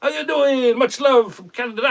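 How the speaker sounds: put-on a light, joking Italian accent to welcome Valentino; mock Italian accent. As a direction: playfully put on an Italian accent to greet the fans. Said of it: "I'm sorry about the accent".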